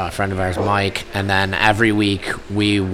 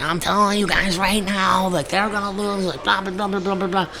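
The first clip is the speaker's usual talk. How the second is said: high, softer mocking kid voice; high nasal-free brat imitation, softer; bratty teen; 8 semitones higher, 4 dB quieter than usual. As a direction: mimic a smug teenager droning on.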